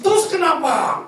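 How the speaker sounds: loud, high "so what?"; defiant retort. Acted. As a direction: throw it back defiantly. Acted defiant retort to a reminder.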